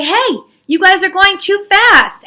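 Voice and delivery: high-pitched acted whining; upset complaint. Stragglers at the back complain to the leaders, whiny. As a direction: protest like a grumbling straggler. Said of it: "get upset. They say".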